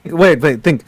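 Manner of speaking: ordinary talk, nothing marked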